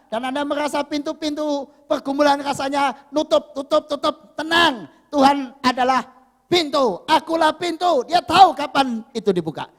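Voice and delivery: loud, emphatic sermon proclamation; full-force preaching. Real, not acted. Preacher reassuring troubled congregation about the door, proclaiming. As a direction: drive each phrase home forcefully.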